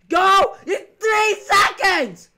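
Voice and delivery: very loud, high, drawn-out yell; desperate full-volume shout; frantic scream. Real, not acted. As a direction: scream the order with no time left. Last seconds to reach farmhouse.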